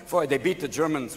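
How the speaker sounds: normal talking voice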